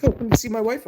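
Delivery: high, loud eager voice; high stranger offer. Retold or imitated. Stranger inviting the streamer to see his wife, high eager. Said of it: "And then he's like".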